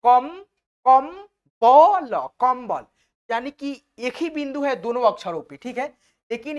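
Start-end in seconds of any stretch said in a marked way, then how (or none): 0.0-2.8 कम, कम, बल then कंबल, slow and loud; syllable by syllable. Shown as a demonstration. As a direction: sound out कम and बल, then say कंबल whole. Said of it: "देखो यहाँ पे". (कम-बल अलग-अलग, ज़ोर से धीरे बोलना)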